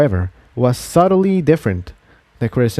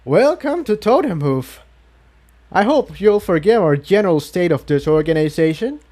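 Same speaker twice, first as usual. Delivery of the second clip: higher, eager welcoming voice; chatty guide Quill Dipper; 7 semitones higher than usual. A narrator's reading. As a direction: greet the newcomers like a keen guide. Quill Dipper greets the newcomers.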